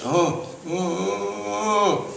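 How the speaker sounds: put-on high, thin vacant old woman's ohs; frail old woman's oh. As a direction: hum it out like a confused old star.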